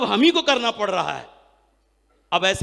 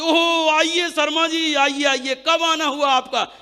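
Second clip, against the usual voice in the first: put-on higher, slow, gushing hello to an old friend; very loving welcome; 8 semitones higher, slower than usual. Old rival welcoming the returning leader back at the venue, overjoyed. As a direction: greet him like a long-lost brother, slowly and sweetly. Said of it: "तो एकदम बड़े प्यार से".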